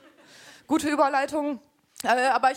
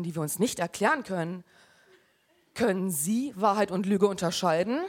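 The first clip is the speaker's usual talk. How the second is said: horror trailer voice; 4 semitones lower than usual. Acted. Mock mystery show intro voice.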